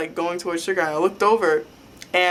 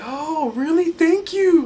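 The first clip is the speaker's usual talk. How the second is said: high, happy gushing thanks; imitated overjoyed reaction, pitched way up; delighted; 12 semitones higher, 3 dB louder, slower than usual. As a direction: squeal your thanks in happy surprise. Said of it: "so happy that someone said that".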